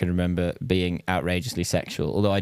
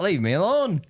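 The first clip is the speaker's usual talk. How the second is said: mock nan voice; 9 semitones higher than usual. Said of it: "She'd be like".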